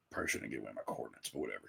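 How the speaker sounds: very soft, low and quick; muttered aside. Real, not acted. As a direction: mutter it under your breath, as an aside. Wry aside about revealing location.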